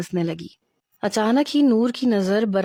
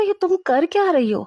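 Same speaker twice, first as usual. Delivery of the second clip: high exasperated question; what on earth are you doing, pitched up; exasperated friend, much higher; baffled; 9 semitones higher than usual. Acted. Kinza baffled by Noor's dragging.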